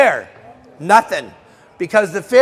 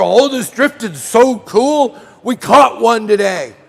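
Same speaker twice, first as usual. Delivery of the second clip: slow, sing-song mock of a lucky angler; a boastful drifter, lightly hammed-up; mock happy drifter; 3 semitones higher, 4 dB louder, slower than usual. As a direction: parody a smug drifter gushing about a fluke catch.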